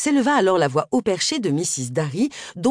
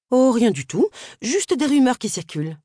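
evasive neighbour; 7 semitones higher than usual. Acted. Neighbour dodges Agatha's question.

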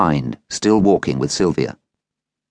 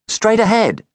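put-on boy's voice. A narrator's reading. Boy gives directions.

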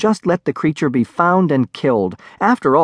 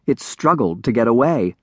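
usual speaking voice

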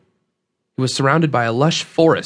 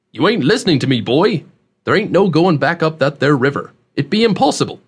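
emphatic folksy country dialect; rustic old man, insistent; 3 semitones higher than usual. Acted. Host warns young man about going upriver, insistent rustic voice. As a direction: set the youngster straight in a backwoods twang.

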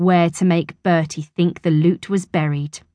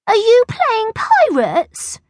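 put-on very high, drawn-out child voice; slow, high-pitched girlish question; eager little girl; 4 dB louder, slower than usual. A narrator's reading. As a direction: play a little girl asking to join in. Angela pesters Bertie to join in.

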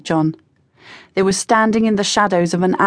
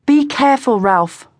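warning; 6 semitones higher, 3 dB louder than usual. A narrator's reading. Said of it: "Beth warned".

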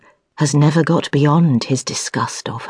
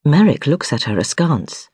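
plain reading voice

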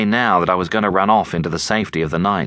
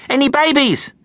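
high-pitched, anxious squeak. A narrator's reading. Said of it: "squeaked Amy".

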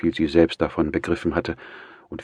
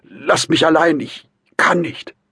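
high, loud, desperate plea; strained, high-pitched outburst; distraught man; 12 semitones higher, 7 dB louder than usual. A narrator's reading. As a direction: beg them loudly to leave you be. Tormented man begs to be left alone.

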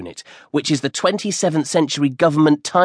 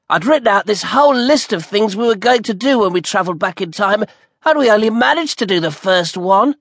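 put-on higher, distinct boy voice, teasing; cheeky best friend; 7 semitones higher than usual. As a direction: needle your friend with a cheeky reminder. Brian laments his list.

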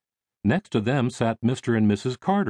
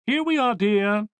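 high, sing-out motherly call; 12 semitones higher than usual. A narrator's reading. Mother waving to son across reception room, calling out. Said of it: "Missus Thayer called out".

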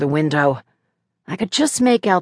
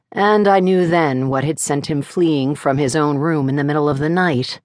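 usual speaking voice

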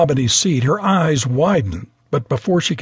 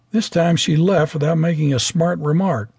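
ordinary delivery

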